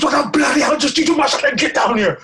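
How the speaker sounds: high, quick mock scolding; hammed-up exasperated yell; retold angry shout. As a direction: bark it up at someone stuck overhead.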